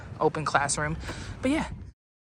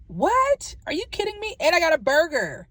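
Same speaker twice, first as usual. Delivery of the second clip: high-pitched disbelieving "What?"; surprised; 10 semitones higher, 4 dB louder than usual. Real, not acted. Vlogger amazed at wings from Chick-fil-A, high disbelief. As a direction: leap up high in real amazement.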